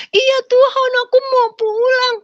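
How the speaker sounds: put-on very high, loud child's wail; anak kecil menangis. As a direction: imitate a crying little child pleading to go home. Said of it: "kayak anak kecil di hadapan Tuhan". (rengekan anak kecil sambil menangis)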